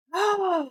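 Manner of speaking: sudden high gasp of surprise; a real startled gasp; sharp gasp. Real, not acted. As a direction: catch your breath in shock. Name found in credits.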